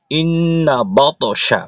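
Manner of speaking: slow ইন্না বাতাসা with the nn held long; nasal ghunnah. Shown as a demonstration. Teacher reciting after explaining the rule, slow nasal ghunnah. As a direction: draw ইন্না through the nose before বাতাসা. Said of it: "নুনে তাসদিদ ওয়াজিব গুন্না".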